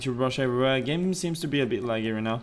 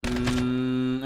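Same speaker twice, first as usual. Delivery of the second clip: ordinary delivery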